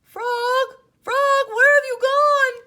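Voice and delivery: very high, loud desperate call; frantic call. Acted. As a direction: call for a lost friend. Toad frantic.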